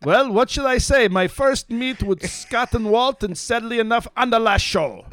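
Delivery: high, loud German officer voice; Colonel Klink. Retold or imitated. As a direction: bark it like a comic German colonel. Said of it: "You do a Colonel Klink".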